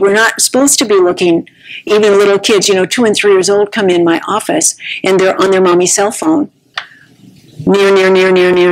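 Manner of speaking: usual speaking voice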